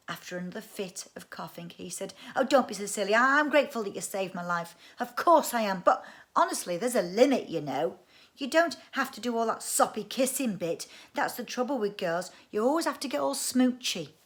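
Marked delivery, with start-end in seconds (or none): 2.3-14.1 put-on higher, louder boy grumbling; exasperated boy voice. Boy argues back with sister.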